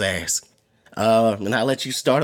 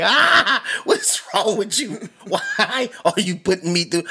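mock exasperated yell; 7 semitones higher, slower than usual. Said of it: "where you just about to yell like".

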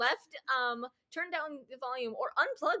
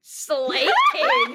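excited cheer; 12 dB louder than usual. Real, not acted.

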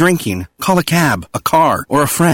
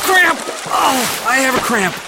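panicked yelp; 11 semitones higher than usual. Acted. Swimmer gets cramp in water.

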